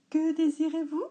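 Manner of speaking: high, loud chirpy waitress. A narrator's reading. Waitress greeting dad, high and loud. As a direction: greet the customer brightly.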